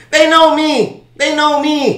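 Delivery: high, loud repeated boast; defiant shouting. Pretend. Accused snitch boasting about the streets, defiant shouting. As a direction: holler it as a street-cred brag.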